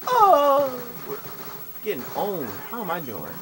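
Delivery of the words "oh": loud, high startled cry; shocked oh. Real, not acted. Gamer getting ambushed, loud startled cry. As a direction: yelp at a sudden death.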